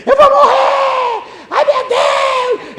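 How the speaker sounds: shrieked very high and drawn out in terror; panicked scream. Retold or imitated. Terrified neighbour shrieking from his house, high panicked wail. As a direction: wail it like a man sure he is dying. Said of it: "uma pessoa gritou assim".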